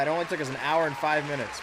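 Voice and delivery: usual speaking voice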